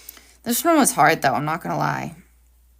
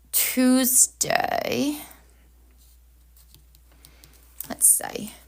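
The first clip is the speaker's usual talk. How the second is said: whispering; 3 semitones higher, 4 dB quieter, much slower than usual. Real, not acted. Muttering to herself while planning.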